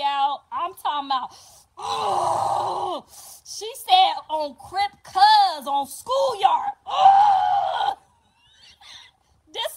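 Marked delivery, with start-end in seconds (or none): 1.8-3.4 loud gasp of shock; sharp gasp. Real, not acted. Grandma gasping at the birth. 6.8-7.9 very high, loud gasp; huge shocked gasp. Real, not acted. Grandma gasping at the gang call.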